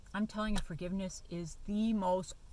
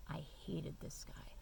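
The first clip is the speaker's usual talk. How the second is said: whispered hatred; 3 semitones lower, 11 dB quieter than usual. Real, not acted. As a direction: breathe it out like a confession. Confessing long hatred.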